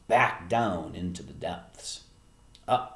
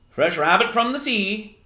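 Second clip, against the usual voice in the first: loud, raised fisherman's boast; old sailor calling out his catch; calling back; 13 semitones higher, 10 dB louder than usual. Acted. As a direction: holler the joke across the street. Fisherman calls back to woman.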